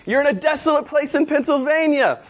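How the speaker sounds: high-pitched acted comeback; mock exasperated retort. Doctor Brian answers John on the mercy trip, mock exasperated retort.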